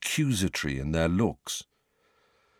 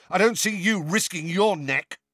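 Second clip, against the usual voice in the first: high, raised jeer; bellowing taunt; 12 semitones higher than usual. A narrator's reading. Fred jeering at Sirius, high and raised. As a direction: throw the jab at him, high. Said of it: "bellowed Fred".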